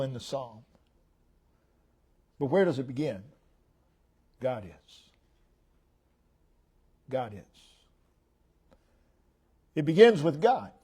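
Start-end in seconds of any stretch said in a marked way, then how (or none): 4.4-5.0 1 soft answer. Real, not acted. Soft answer to own question. 7.1-7.6 1 soft answer. Real, not acted. Soft answer to own question.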